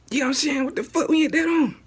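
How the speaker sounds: high, loud exasperated yell; real frustrated shouting; angry outburst. Real, not acted. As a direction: blow up at the government. Exasperated rant about government debt.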